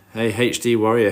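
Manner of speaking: ordinary delivery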